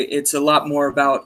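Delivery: usual speaking voice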